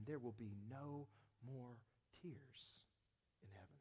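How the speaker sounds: hushed closing whisper. Real, not acted. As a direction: breathe the word tears. Whispered ending.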